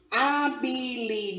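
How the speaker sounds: HABILIDADE slowly split, with a long DA; syllable by syllable. Shown as a demonstration. Teacher showing a polysyllable, HABILIDADE slowly syllable by syllable. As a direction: sound out HA-BI-LI-DA-DE beat by beat. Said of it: "a gente tá representando três".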